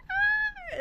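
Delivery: very high piercing cry of pain; high yell. Retold or imitated. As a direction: shriek out for the midwife. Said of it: "So I yelled my midwife's name".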